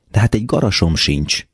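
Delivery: plain reading voice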